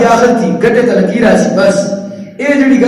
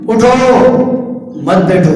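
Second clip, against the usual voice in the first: "اٹھو", stop, then falling "مت بیٹھو"; pause after اٹھو. Shown as a demonstration. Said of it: "الفاظ ہی ہوگی".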